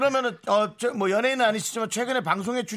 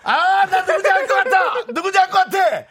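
high, loud eureka; excited; 14 semitones higher, 8 dB louder than usual. Real, not acted. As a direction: burst out with excitement. Realizing who the caller is.

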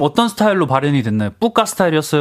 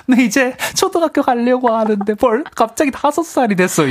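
put-on sulky child voice; 10 semitones higher than usual. Voicing the sulking nephew.

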